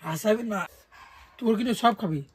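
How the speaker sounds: ordinary talk, nothing marked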